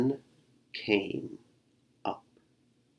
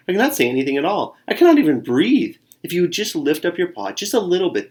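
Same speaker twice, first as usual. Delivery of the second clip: fast pleading; 6 semitones higher, faster than usual. Acted. Squirrel pleads under paw.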